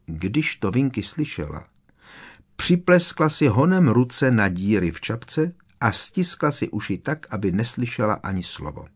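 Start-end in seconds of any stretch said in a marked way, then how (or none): none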